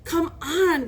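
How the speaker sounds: plain reading voice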